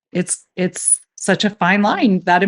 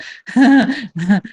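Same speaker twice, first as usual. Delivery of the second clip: short, higher-pitched uncomfortable giggle; imitated nervous giggle; 6 semitones higher, 4 dB louder than usual. Sister imitates brother's nervous giggle at the funeral home. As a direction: giggle awkwardly, out of nerves. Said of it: "his go-to is kinda like a".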